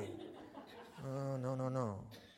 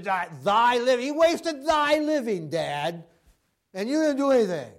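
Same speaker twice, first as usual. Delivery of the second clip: accusing brother; 3 semitones higher, 5 dB louder than usual. Retold or imitated. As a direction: throw the blame at Dad. Elder brother accusing father.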